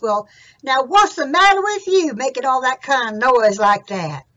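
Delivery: loud called question; Jack calling out. Acted. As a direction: holler over to the cow. Jack calls to cow.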